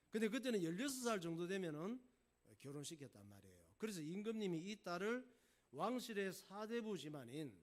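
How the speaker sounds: ordinary delivery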